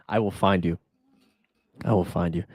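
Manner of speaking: low, menacing mock threat, lightly done; mock movie threat. Joking to Ryan about bad news, low mock menace. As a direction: play the calm movie villain as a joke.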